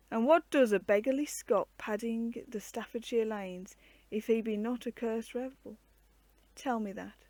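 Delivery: ordinary delivery, nothing marked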